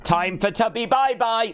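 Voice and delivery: put-on high, bright kids' show sign-off, then a giggle; mock kids TV presenter. To the chat, a mock kids TV host goodbye. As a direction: play a children's TV host saying goodbye.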